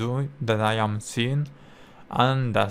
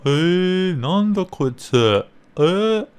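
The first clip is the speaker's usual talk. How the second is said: loud, high-pitched Japanese YouTuber impression; imitating a YouTuber; 7 semitones higher, 7 dB louder than usual. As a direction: exclaim it like an over-the-top Japanese YouTuber. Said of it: "I imitate one YouTuber".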